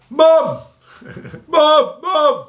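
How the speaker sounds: very high, loud mock wail; bawling for mum. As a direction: call out for your mother like a panicked kid, hammed up. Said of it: "I bawled".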